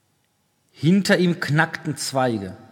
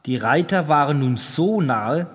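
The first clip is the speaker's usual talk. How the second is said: usual speaking voice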